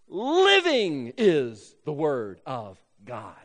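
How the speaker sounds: living drawn out and stressed, then slow and falling. Shown as a demonstration. Preacher showing the Greek word order, stress on living. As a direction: lean hard and long on "living", then slow right down. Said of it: "It's emphasizing that word".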